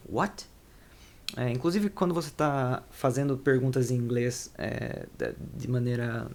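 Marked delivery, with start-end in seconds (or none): 0.1-0.5 What thrown out higher with a sharp rise; blunt what. Shown as a demonstration. Teacher showing students a rude reply, blunt rising question. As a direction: toss What back like you didn't catch it, bit rude. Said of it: "soa meio grosseiro".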